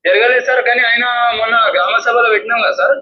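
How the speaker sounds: normal talking voice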